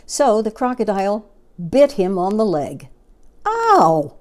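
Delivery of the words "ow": high, loud ow; yelp of pain. Acted. Elephant bitten on the leg, yelping. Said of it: "said Trunky".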